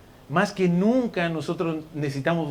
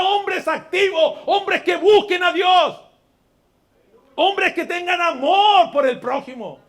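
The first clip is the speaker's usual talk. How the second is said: fiery preaching; 11 semitones higher, 4 dB louder than usual. Acted. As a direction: drive it home to the congregation. Preacher at full force.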